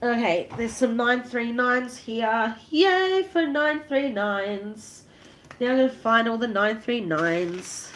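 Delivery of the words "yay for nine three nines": slow, drawn-out sing-song "Yay"; little cheer. Real, not acted. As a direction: cheer happily over the beads. Cheering at finding the beads.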